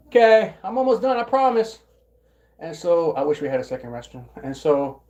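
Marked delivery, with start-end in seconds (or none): 0.1-1.8 loud, high holler of reassurance; calling out. Real, not acted. Speaker to someone waiting for the restroom, calling out. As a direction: raise the voice to someone out of the room.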